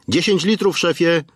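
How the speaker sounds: calling out. A narrator's reading. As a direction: call the boss from the doorway. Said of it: "Zawołał Roman od drzwi".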